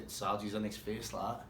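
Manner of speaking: ordinary delivery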